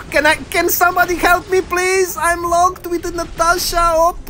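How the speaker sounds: high-pitched call for help; urgent plea. Real, not acted. Gamer asking teammates for help while locked, urgent high plea. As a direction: plead urgently, voice held high. Calling for help mid-game.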